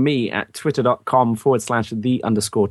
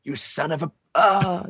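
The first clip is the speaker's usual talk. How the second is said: mock last words; 4 semitones higher than usual. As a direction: act out a comic death with a final groan. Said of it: "It'd be your last words".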